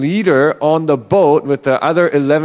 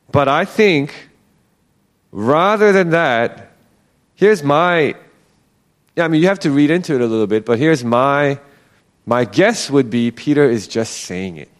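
usual speaking voice

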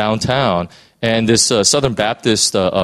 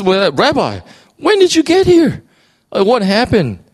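much higher and louder, almost squeaking with amazement; astonished disciple; 11 semitones higher, 3 dB louder than usual. Acted. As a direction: blurt it out in total surprise.

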